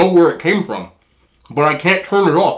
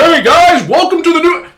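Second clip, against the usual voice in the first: much higher, louder on-camera greeting; hyped YouTuber intro; 7 dB louder than usual. Retold or imitated. Mocking creators' on-camera intro to viewers, high and loud. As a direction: switch on the big host voice. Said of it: "all of a sudden, like".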